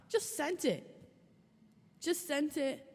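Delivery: high sing-song mock of sent it. Real, not acted. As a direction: toss it off like he did.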